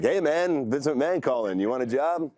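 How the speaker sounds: a friend faking a big-boss call; mock prank caller. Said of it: "going like".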